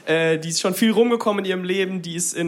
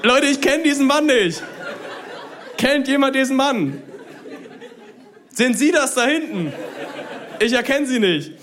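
raised, incredulous call to the crowd; high-pitched public appeal; 6 semitones higher than usual. Acted. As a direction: call it out high, as if searching for someone. Comic bit calling out to audience.

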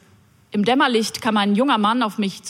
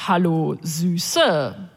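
lower, oily pickup line; oily come-on; 4 semitones lower, much slower than usual. Retold or imitated. Man at the bus stop chatting her up, oily and lower. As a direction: purr "Süße" like a sleazy flirt. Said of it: "mit einem öligen Lächeln".

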